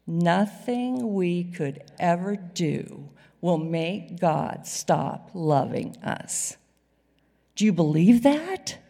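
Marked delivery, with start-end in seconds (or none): 0.1-6.6 1 slow group recitation. Shown as a demonstration. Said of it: "I want you to say that with me".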